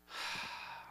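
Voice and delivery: breathy, weary exhale; heavy sigh. Acted. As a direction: let out a long sigh. Voicing the unworthy son.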